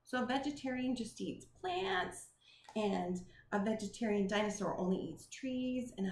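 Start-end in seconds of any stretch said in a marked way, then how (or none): none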